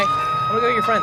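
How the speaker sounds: sing-song high falsetto taunt; teasing falsetto. Pretend. Killer streamer taunting a downed survivor in falsetto. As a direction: coo it high like a playful threat.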